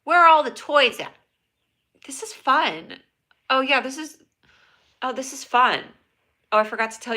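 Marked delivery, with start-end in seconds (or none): 0.0-1.1 high, loud call. Real, not acted. Calling out. 2.1-5.8 high, loud fake cheer; sarcastic cheer. Forced cheer.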